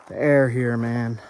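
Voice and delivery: ordinary delivery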